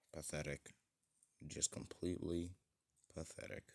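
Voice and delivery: soft, weary contempt; contemptuous sigh. Acted. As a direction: let it out like a disappointed villain, hushed. Villain sneering at would-be hero.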